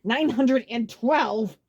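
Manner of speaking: slow, loud, gritted-teeth count; through gritted teeth. A narrator's reading. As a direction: grind it out furious. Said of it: "spoke through gritted teeth".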